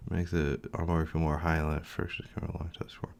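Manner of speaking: whispered reading to himself; whispering. Real, not acted. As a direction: whisper the text under your breath. Reading doc under breath.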